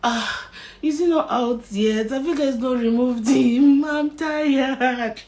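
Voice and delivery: patient whining mid-surgery; tired complaining. Retold or imitated. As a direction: moan impatiently at the doctors. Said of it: "the only thing I was saying is".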